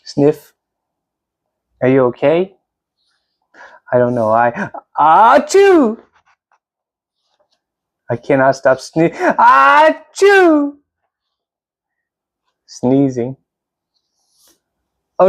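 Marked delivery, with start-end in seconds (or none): 4.3-6.0 sneeze. Acted. Character sneezing. 9.4-10.8 big mock sneeze. Character sneezing.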